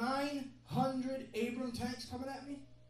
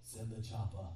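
casual radio request; 11 semitones lower, 6 dB quieter than usual. Retold or imitated. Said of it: "be like".

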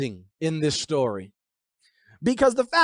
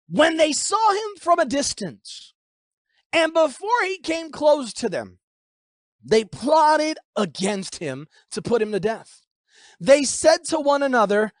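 raised, emphatic verse reading; scripture reading; 5 semitones higher than usual. Acted. Preacher reads verse eighteen, raised and emphatic. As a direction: declaim the plot in measured phrases.

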